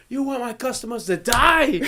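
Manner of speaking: high-pitched mock alarm; incredulous chef's rant; imitated outraged chef. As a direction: accuse the cook, hammed up.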